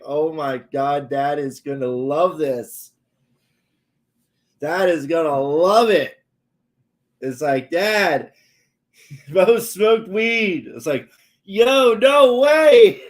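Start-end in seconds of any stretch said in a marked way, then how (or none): 0.0-6.2 rising giddy excitement, slow, ending higher and louder; excited mom. Retold or imitated. Mom thrilled the son smoked weed, excited. 7.7-10.7 high, loud, slow call across the house. Retold or imitated. Mom calling dad with the news, loud and laughing. 11.4-13.0 much higher, much louder, gleeful; delighted disbelief. Retold or imitated. Dad hearing his son smoked weed, thrilled.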